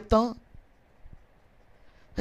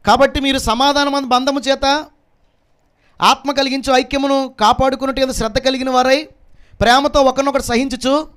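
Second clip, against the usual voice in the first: scripture reading; 4 semitones higher than usual. Acted. Preacher reads the epistle to the congregation, proclaiming. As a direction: proclaim the verse in a sustained high voice.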